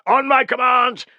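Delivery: high, loud battlefield order; shouted command. Acted. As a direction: carry the order to the rear ranks.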